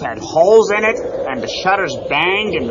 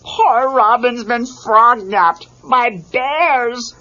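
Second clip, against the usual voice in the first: higher, slower fretful bear; worried bear voice; 5 semitones higher, 4 dB louder than usual. A narrator's reading. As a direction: lament like a nervous bear. Fozzie voiced in story.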